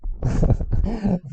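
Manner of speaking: amused burst of laughter. Real, not acted. Teacher laughing at how crazy the drill looks, real laugh. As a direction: laugh loudly at yourself.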